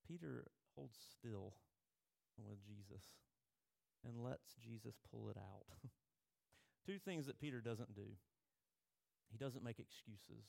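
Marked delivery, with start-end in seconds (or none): none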